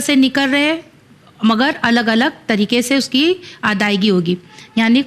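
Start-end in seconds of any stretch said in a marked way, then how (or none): none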